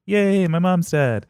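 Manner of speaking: high, slow mock cheer; cheery Electra. Electra celebrating her mother's death, high and slow. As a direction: drag out the fake joy. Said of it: "Electra's like".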